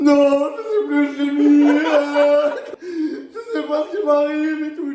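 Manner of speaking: high, loud fake sobbing; hammed-up fake tears for the nurse; fake crying. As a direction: panic act through tears. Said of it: "me faire passer pour un fou".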